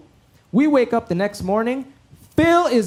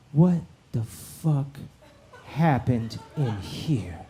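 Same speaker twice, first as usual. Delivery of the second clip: lower, slow, menacing mother's voice; a slow, low, ominous mom; angry mom; 10 semitones lower, 10 dB quieter, slower than usual. Retold or imitated. Mom confronting the boys over the wrecked house, low and menacing.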